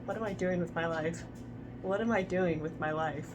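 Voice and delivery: soft, defeated mumbling to oneself; quiet despair. Real, not acted. Reader regrets making this video series, quiet despair. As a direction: say it very softly, questioning your choices.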